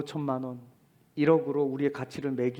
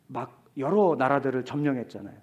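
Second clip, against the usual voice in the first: ordinary talk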